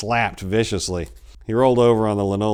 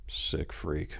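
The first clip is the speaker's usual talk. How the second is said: much softer, low murmur under his breath; 3 semitones lower, 10 dB quieter than usual. A narrator's reading. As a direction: mutter it in disgust to himself. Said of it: "murmured Matt".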